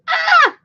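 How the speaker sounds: high shrill mock scream; retold scream. Neighbor startled by a dog, screaming. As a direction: let out a sudden startled scream. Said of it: "So she goes".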